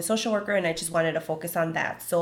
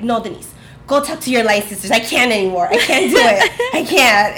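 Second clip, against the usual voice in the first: louder, laughing "I can't" to her friends; laughing exasperation; 4 semitones higher, 9 dB louder than usual. Retold or imitated. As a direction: give up on them with a laugh, walking away. Myra brushing off friends, laughing.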